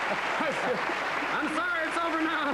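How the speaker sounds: high, very slow mock-tragic apology; mock sorrowful. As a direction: ham up a sad farewell. Mock tearful farewell.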